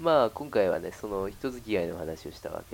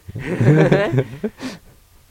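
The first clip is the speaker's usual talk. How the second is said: loud rising laugh; 7 semitones higher, 18 dB louder than usual. Real, not acted. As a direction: burst out laughing loudly. Laughs at joke.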